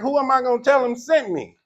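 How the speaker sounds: a puzzled man asking who; imitating Moses. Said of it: "he's like".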